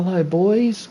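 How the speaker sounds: ordinary talk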